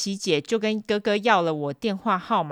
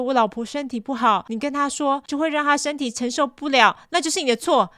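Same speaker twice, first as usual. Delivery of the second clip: higher, whiny guilt trip; guilt-tripping boss; 6 semitones higher than usual. Retold or imitated. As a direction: pin the blame on her. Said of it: "老板还说".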